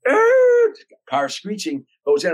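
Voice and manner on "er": very high, loud imitated screech of a car; imitated engine roar. Narrator imitates the car tearing into the driveway, loud screech. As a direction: belt out the car's screech as a sound. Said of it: "And I hear".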